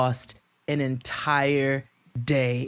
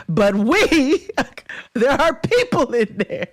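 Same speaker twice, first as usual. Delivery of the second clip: laughing insistence; 10 semitones higher, 5 dB louder, slower than usual. Retold or imitated. Laughing retort to staff.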